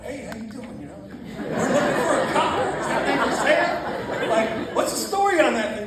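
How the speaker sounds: lightly hammed-up salesman buddy; imitating Tim. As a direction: play the smooth talker, laughing. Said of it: "here goes Tim".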